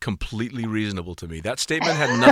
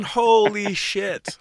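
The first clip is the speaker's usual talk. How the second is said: drawn-out stunned exclamation; slow, high disbelief; amazed; 9 semitones higher, much slower than usual. Real, not acted.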